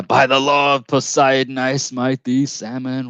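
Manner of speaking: high, grand mock-Poseidon proclamation; hammed-up sea god proclamation. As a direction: declare it like a sea god striking. Mock Poseidon spear strike.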